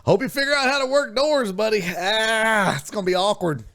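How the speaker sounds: leaving the armadillo in its trap, teasing; mock farewell. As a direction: talk sweetly down to the animal.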